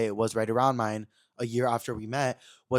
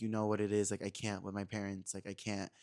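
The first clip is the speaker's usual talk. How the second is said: emotional; 4 semitones lower, 8 dB quieter than usual. Retold or imitated. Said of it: "looked at me a little emotional".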